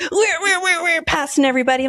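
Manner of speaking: high-pitched zooming imitation; mock frantic driver. Imitating a defensive driver speeding through traffic, mock frantic. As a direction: race it like a car whizzing past.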